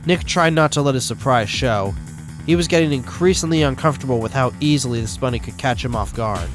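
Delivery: ordinary delivery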